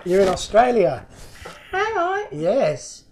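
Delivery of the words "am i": very high little creature question; toy koala voice. Pretend. Koala toy learning it's in Australia, high put-on voice. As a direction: squeak back in mock wonder.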